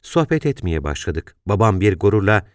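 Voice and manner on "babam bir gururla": plain reading voice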